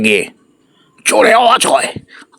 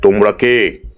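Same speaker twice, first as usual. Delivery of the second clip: much lower, measured guardian voice; stern gatekeeper; 5 semitones lower than usual. Acted. Treasure guardian stopping the brothers, low and stern. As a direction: halt them with low authority. Guardian stops them, asks riddle.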